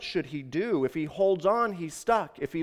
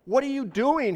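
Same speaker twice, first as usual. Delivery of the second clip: high, incredulous question; raised pitch without a full shout; calling out; 9 semitones higher than usual. A narrator's reading. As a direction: call down in disbelief, pitched well up. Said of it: "he called out again".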